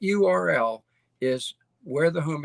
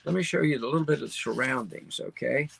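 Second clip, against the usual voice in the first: normal talking voice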